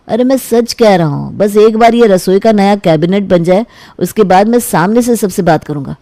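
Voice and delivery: usual speaking voice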